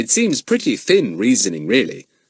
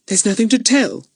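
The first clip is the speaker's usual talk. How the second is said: put-on high, sulky girl's voice; 13 semitones higher than usual. A narrator's reading. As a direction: sulk through the line. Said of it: "rather sulkily".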